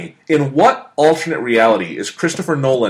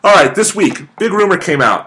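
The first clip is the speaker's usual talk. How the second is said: usual speaking voice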